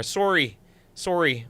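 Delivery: "Sorry" in a northern Wisconsin, near-Canadian accent. Shown as a demonstration. Host saying sorry for the viewers, Wisconsin accent. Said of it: "Oh yeah, I'll say sorry".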